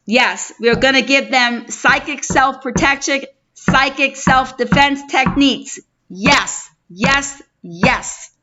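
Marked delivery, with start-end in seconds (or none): none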